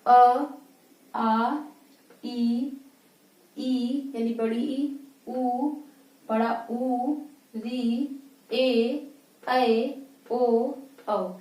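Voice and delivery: each vowel said clearly with no nasal sound, one by one; vowels said from the mouth only. Shown as a demonstration. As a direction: say अ, आ, ई, उ, ए, ओ, औ through the mouth only, no air through the nose. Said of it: "बोल के देखिए".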